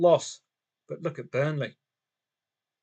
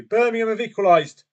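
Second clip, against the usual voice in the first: excited announcement; 6 semitones higher, 8 dB louder than usual. Real, not acted. Sudden goal news.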